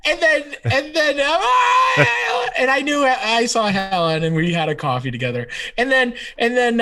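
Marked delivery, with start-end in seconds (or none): 1.4-2.5 high laughing shout; big laugh; loud laugh. Real, not acted.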